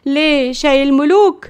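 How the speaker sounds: high, loud opening, then sarcastic questioning; exasperated grandmother's rebuke; indignant scolding. Acted. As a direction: tell the boy off in disbelief. Grandmother scolds the tea-selling boy.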